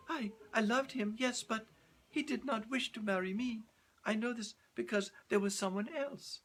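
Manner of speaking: put-on high, earnest young woman; young girl's voice. Girl confessing to Leonardo, high earnest young voice.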